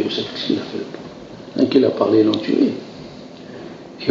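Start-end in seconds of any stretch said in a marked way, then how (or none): 0.0-1.6 whispered, slow rhetorical question; hushed question. Acted. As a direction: drop to a breathy whisper to draw them in.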